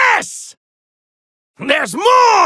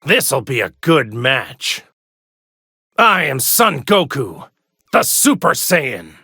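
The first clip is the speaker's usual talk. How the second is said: low hero intro; 13 semitones lower than usual. Acted. Game intro line.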